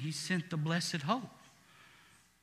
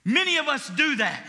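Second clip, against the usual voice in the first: ordinary delivery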